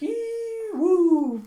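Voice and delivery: piercing "ee" into a loud "woo"; high squeal. Retold or imitated. Storyteller voicing a reaction to the challenge, high squeal.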